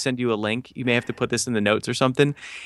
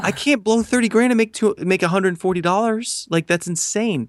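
higher, pressed, half-disbelieving protest; exasperated plea; 7 semitones higher than usual. Retold or imitated. Telling the ad company it's insane, exasperated. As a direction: argue you can't afford this.

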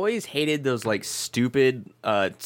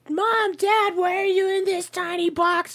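much higher, squeaky little creature voice; high squeaky cat voice. Retold or imitated. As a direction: voice the pets as tiny panicked critters. Voicing the cats trapped inside.

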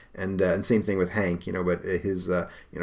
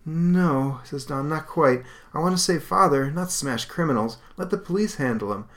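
put-on much higher, calm firm young voice; earnest refusal; 7 semitones higher than usual. Don telling the spirit he only wants to save Father. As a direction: refuse it gently but firmly.

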